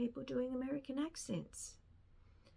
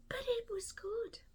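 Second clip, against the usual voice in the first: "but it was good" breathed out in a whisper; whispered aside; 13 semitones higher than usual. Real, not acted. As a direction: confide it under your breath. Whispered verdict, then repeated.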